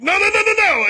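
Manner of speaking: high, loud squealing no; mock demon wail. Host voicing the expelled demon, squealing high and loud. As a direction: wail like a demon being cast out. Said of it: "you'd be like".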